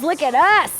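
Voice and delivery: ordinary delivery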